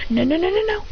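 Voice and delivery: lightly acted alarm; mock panicked protest. Teacher protests being thrown.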